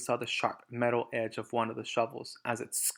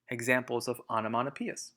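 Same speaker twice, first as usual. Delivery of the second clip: usual speaking voice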